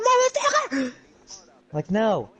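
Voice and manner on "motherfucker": high shouted mock curse. Imagined student shouting in class, high mock shout. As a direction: yell it out of nowhere. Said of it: "start shouting".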